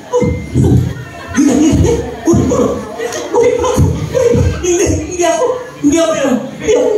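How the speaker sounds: a father in hammed-up panic; panicked denial. Father panicking, denying it's him.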